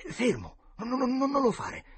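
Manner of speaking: high, loud, alarmed cry; urgent warning. A narrator's reading. Tom stops his friend from using the pin, urgent warning. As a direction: stop him in alarm.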